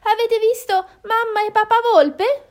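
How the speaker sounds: high, loud question; high-pitched, rising appeal; eager little enquirer. Acted. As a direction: call out the question in a small high voice. Hen asks boars about fox parents.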